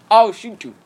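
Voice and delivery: put-on high, loud British-accent threat; mock British accent. Retelling a film threat to a friend, loud mock British accent. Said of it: "That's a great British accent".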